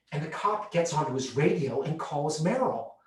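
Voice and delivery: normal talking voice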